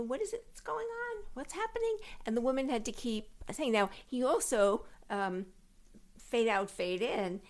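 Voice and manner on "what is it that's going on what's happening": high, puzzled questions; clueless man in the audience, high and puzzled. Retold or imitated. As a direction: mimic a confused theatregoer asking. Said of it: "he kept asking her questions, like".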